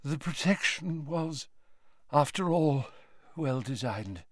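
put-on soft, faint old man; faintly. A narrator's reading. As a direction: fade out with exhaustion. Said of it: "said Dumbledore faintly".

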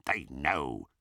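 low, soft goblin warning; Griphook whispering. A narrator's reading. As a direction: murmur a secret warning, pitched low. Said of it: "whispered Griphook in Harry's ear".

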